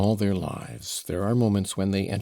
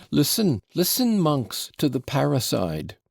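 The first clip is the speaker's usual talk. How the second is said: cried out; 7 semitones higher than usual. Acted. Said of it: "cried Fyodor Pavlovitch".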